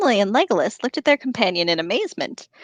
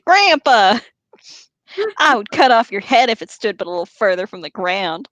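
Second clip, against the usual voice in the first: put-on Southern accent. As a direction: read it with a touch of Southern twang. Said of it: "in a Southern accent".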